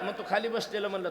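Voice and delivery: ordinary talk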